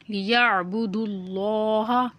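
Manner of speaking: लियअबुदुल्लाह recited slowly with a thick, rounded "llaah"; full, heavy Allah. Shown as a demonstration. Teacher showing learners tajweed, लियअबुदुल्लाह slow with a full-mouthed Allah. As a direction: draw out लियअबुदुल्लाह, making the Allah part heavy. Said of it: "लफ्ज़ अल्लाह को यहाँ मोटा यानी पुर करके पढ़ेंगे".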